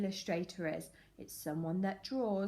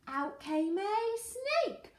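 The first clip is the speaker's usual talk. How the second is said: reveal; 10 semitones higher, slower than usual. A narrator's reading. Reveal of the snake.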